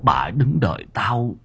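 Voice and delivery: dying grandfather whispering his last wish; feeble whisper. Acted. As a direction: barely get the words out. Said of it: "miệng thều thào".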